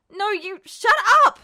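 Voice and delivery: high, loud frightened yell; panicked shout. A narrator's reading. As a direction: shout it out in terror. Ed panics at Roy.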